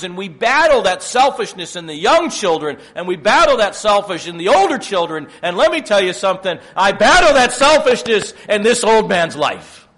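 loud, high, driving sermon delivery; full-force preaching. Acted. Preacher warning the church about selfishness, booming. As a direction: preach it hard at the congregation.

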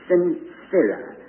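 sincera said slowly, syllable by syllable; Latin word sincera. Shown as a demonstration. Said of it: "It comes from two words".

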